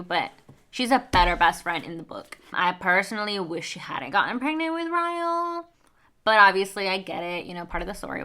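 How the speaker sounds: normal talking voice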